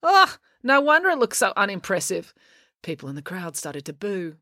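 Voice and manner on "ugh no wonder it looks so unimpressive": disgusted ugh, pitched up; scornful snort. A narrator's reading. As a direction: snort with mild disdain. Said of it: "April snorted".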